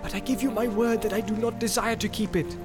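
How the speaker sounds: high-pitched, pressing appeal; urgent protest climbing in pitch; insistent pleading. A narrator's reading. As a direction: plead your honesty, pushing for what you want. Boromir pleads for the ring.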